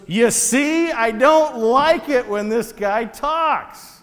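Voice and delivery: high, loud, drawn-out whine; hammed-up petulant protest, pitch way up; whining complaint. King complaining after hearing he will be killed, whiny. King's acted complaint about the prophet.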